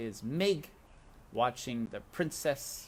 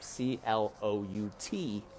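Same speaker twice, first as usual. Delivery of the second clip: usual speaking voice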